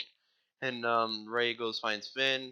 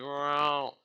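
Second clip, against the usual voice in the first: usual speaking voice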